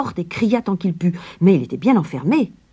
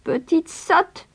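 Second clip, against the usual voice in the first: high, slow scornful crone; scolding witch; 13 semitones higher, slower than usual. A narrator's reading. Witch scolding Gretel, slow high scorn. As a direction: sneer it down at the girl. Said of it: "gronda la sorcière".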